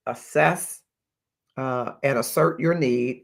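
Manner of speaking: ordinary talk, nothing marked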